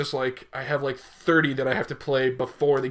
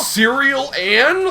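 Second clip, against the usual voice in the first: mocking mispronunciation; 9 semitones higher, 3 dB louder, much slower than usual. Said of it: "one of the many rages".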